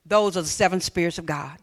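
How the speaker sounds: normal talking voice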